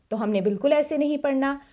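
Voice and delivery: normal talking voice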